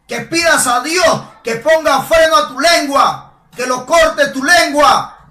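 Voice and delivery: loud, rising preacher's rebuke; fiery sermon voice; preaching at full force. Acted. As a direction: hammer it home like a pulpit preacher. Preacher escalating, shouting warnings.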